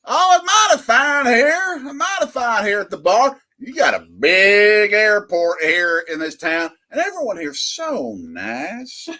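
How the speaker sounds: put-on high-pitched Southern country guy accent; deep south accent. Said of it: "with this deep Southern accent".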